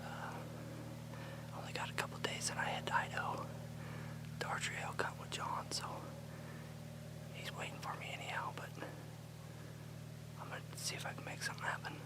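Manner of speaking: hushed hunter's whisper. Real, not acted. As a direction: whisper so the deer won't hear. Whispering while stalking deer.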